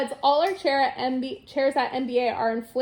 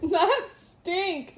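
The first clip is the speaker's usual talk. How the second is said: mildly exasperated, higher-pitched correction; snapped correction; 4 semitones higher than usual. A narrator's reading. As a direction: correct it with a touch of impatience. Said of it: "Mom snapped".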